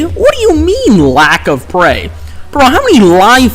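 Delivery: jumps high in disbelief; what do you mean, shocked; incredulous. Real, not acted. Disbelief at game alert.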